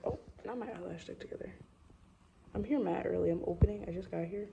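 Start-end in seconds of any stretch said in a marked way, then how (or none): 0.0-1.5 whisper. Real, not acted. Whispering alone at work.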